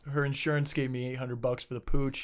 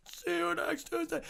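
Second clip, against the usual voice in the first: high, soft strangled squeal; strained cursing; 8 dB quieter than usual. Retold or imitated. Rider over his smashed moped, high muted squeal. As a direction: squeeze the curse out high and small.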